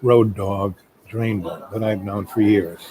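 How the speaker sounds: ordinary delivery, nothing marked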